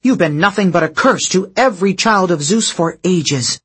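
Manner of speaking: bitter rising accusations; angry. A narrator's reading. Thalia confronts the goddess.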